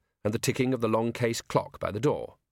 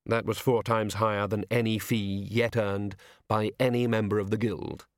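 ordinary delivery

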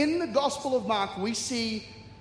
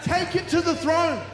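high, loud, driving sermon climax; full-force preaching; 8 semitones higher, 6 dB louder than usual. Real, not acted. Preacher urges church to go to God, shouted exhortation. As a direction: shout it out to the whole congregation.

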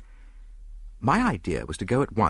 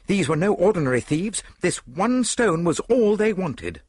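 louder, higher, urgent conclusion; excited deduction; 11 semitones higher, 5 dB louder than usual. A narrator's reading. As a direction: announce the discovery emphatically. Excited conclusion after discovering theft.

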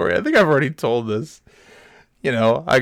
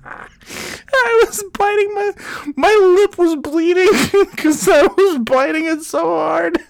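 high, strained voice fighting back laughs; stifled laughter; 12 semitones higher than usual. Real, not acted. Recalling biting lip, now laughing uncontrollably. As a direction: talk through laughter you're trying to hold in. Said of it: "Trying to stifle my uncontrollable laughter".

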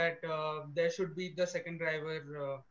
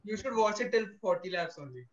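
ordinary talk

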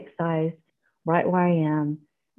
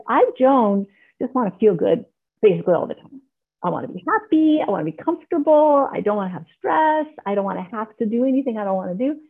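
high-pitched voice of one's own self-will; 4 semitones higher, 3 dB louder than usual. Acted. Speaker voicing own self-will to fellowship, high-pitched insistent wants. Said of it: "'Cause my self-will is like".

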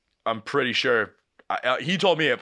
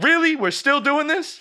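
much higher and louder, outraged disbelief; exasperated disbelief; 5 dB louder than usual. Retold or imitated. Jake to his dad, high, loud exasperated disbelief. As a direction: scoff in loud disbelief, dragging the second line.

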